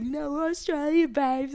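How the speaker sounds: high, drawn-out mock Australian accent. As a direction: imitate an Aussie correcting someone, slowly. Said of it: "I thought they were from the U K".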